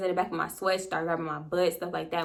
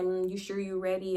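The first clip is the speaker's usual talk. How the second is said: softer imitation of a boyfriend's close talk; retold intimate aside, quietly; murmur in the ear; 8 dB quieter than usual. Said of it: "started talking in my ear".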